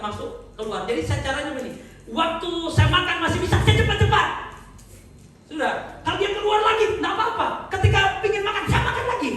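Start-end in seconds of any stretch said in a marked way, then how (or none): 2.1-9.3 high, loud, driving sermon voice; full-force preaching. Acted.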